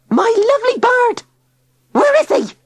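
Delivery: very high, loud frantic wail; shrieking wail. A narrator's reading. Tilly wailing frantically for her bird.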